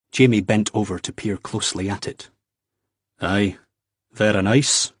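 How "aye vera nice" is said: polite Scots. A narrator's reading. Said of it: "he said politely".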